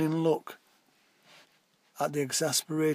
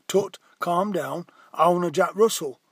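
excited barking; 3 semitones higher, 8 dB louder than usual. A narrator's reading. Shouted after Parky as he cycles off, loud excited barking. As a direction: bark the words out eagerly. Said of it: "an excited barking".

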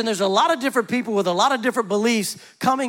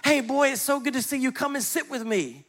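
happy tree; 7 semitones higher, 3 dB quieter than usual. Acted. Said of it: "the tree was so happy to see him".

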